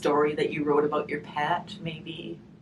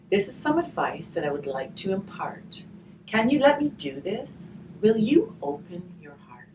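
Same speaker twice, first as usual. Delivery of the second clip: put-on reading to children; 3 semitones higher, 6 dB louder than usual. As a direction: read the rhyme aloud to the children. Reading a rhymed picture book aloud.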